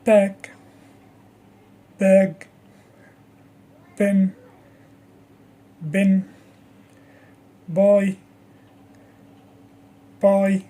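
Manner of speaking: Pack, bag, pen, bin, buy, pie one by one, puffed P, airless B; P and B drill. Shown as a demonstration. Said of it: "practice with a few words".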